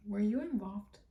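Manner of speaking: softly, as if thinking it privately; silent thought voiced. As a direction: ask it under your breath. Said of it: "I'm, like, in my head".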